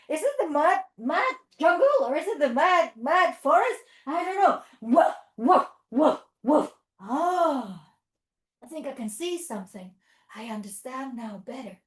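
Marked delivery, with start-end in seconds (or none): 4.8-6.7 lower, louder woofs; low playful barks. Pretend. Teacher barking as a dog for the kids, low and loud. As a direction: bark like a big dog.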